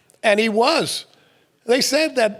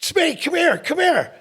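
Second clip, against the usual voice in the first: higher mock coach call; 11 semitones higher than usual. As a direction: call the player over like the old coach. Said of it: "and he's like".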